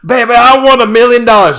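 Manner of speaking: high, loud mock Pennsylvania accent; hammed-up Philly wife voice. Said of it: "given these characters accents".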